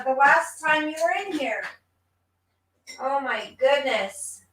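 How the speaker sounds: usual speaking voice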